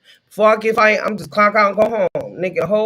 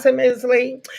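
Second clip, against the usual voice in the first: usual speaking voice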